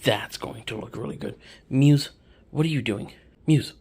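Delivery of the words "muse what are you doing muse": louder, higher call of the cat's name "Muse". Real, not acted. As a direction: call "Muse" across the room. Calling the cat.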